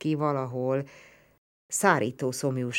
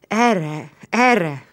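louder, higher urging call; encouraging call; 4 semitones higher, 7 dB louder than usual. A narrator's reading. Narrator guiding the blind Roman toward him. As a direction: coax him this way.

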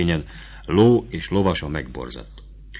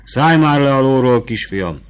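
higher, louder insistence; vivandière repeating the order louder; repeated impatient order; 7 semitones higher, 7 dB louder than usual. Acted. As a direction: tell him again, losing patience. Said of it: "szólt rá ismét a markotányos nő".

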